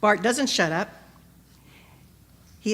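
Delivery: ordinary delivery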